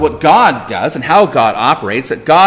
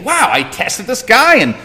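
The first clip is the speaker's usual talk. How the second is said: higher-pitched, hammed-up amazement; mock astonished reaction, pitched up; mock surprise; 6 semitones higher than usual. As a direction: play at being impressed and taken aback. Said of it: "Was God surprised by Abraham's faith?".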